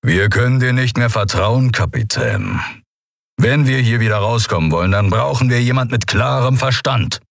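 controlled, gruff verdict; restrained, stern rebuke; composed growl. A narrator's reading. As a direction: growl it without losing composure. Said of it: "Überraschend gefasst knurrte er".

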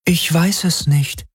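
high, slow, regretful voice; otherworldly woman's voice, drawn out; regretful female entity. A narrator's reading. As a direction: answer slowly and high, with regret. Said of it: "Sie klang bedauernd".

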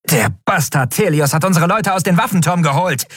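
high, furious commander under fire; heated high-pitched cursing; angry outburst. Acted.

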